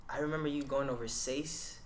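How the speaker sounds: ordinary talk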